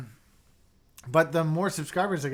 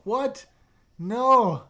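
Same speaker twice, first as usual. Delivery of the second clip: sharp high "What?" then a loud "No"; surprised protest; 8 semitones higher, 7 dB louder than usual. Real, not acted. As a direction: pounce on it in mock disbelief. Viewer says he must leave.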